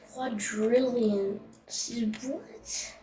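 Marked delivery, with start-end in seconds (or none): 1.7-2.9 breathy, puzzled whisper. Real, not acted. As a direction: whisper it in confusion. Confused whisper at the game.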